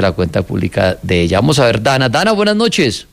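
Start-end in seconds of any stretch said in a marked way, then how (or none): none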